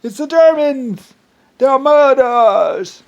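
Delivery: high, loud, slow mock accusation; mock outraged cry. Mocking the accusers, a high, loud, slow outraged cry. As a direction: ham up an accusing shout at foreigners. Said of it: "and then they went and say".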